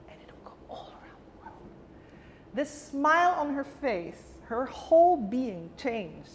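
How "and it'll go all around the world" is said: whispered low, softly, as if sharing a secret; a secret whispered to a hurting girl; hushed whisper. Retold or imitated. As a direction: breathe it to her like a tender promise. Promising the girl her love spreads.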